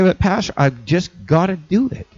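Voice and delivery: high, whiny excuses to the pastor; mock churchgoer dodging Sunday service; excuse-making churchgoer. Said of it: "I've heard people say".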